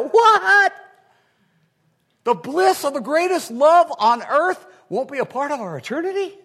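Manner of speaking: high, loud, disbelieving cry of a listener; mock shocked cry. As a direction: burst out in mock horror at no marriage in heaven. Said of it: "I'm hearing your thoughts".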